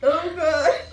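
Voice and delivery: laughing through tears, high and loud; crying and laughing at once; sobbing. Real, not acted. Overwhelmed after an emotional episode.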